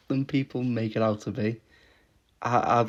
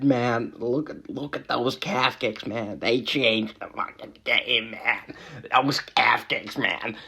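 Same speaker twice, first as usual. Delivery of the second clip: Joe Rogan impression. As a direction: marvel over the calf kicks like a star-struck commentator. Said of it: "I fucking sounded like Joe Rogan there".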